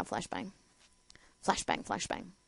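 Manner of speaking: very soft, low repetition; hushed, almost whispered; muttered to self. Pretend. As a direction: toy with the word under your breath. Savouring the word to herself.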